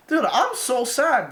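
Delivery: usual speaking voice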